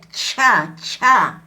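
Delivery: cha with a strong puff of air; aspirated Quechua cha; aspirated chh. Shown as a demonstration. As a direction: breathe out hard on cha. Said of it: "La che".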